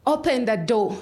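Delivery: demanding shout, held a little in check; retold screaming. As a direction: re-enact a man yelling at a locked door. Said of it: "he kept screaming".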